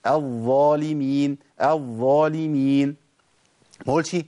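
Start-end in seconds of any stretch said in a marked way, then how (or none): 0.1-3.0 الظالمين with the ظ drawn out slowly; slow correct demo; running ظ. Shown as a demonstration. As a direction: let the air run on the ظ in الظالمين. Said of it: "لازم أجري الصوت".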